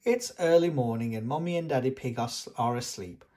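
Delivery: put-on lively storybook reading for small children. A narrator's reading. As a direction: read it like a bedtime picture book. Reads a picture book aloud.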